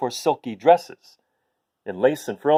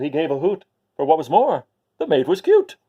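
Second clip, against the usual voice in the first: ordinary delivery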